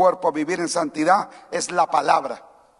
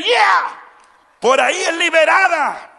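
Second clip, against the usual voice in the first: much higher, louder yelled "Yeah"; demonstrating a worship shout to startle a neighbour; shout of joy; 9 semitones higher, 6 dB louder than usual. Shown as a demonstration. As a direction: let out a liberated yell. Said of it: "pega un grito".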